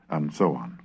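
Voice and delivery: ordinary talk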